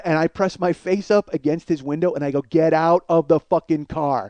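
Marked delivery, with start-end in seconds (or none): 2.4-4.3 slow, emphatic demand; menacing order. Retold or imitated. Man with face against the window orders passenger out, slow and angry. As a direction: space the words out with weight.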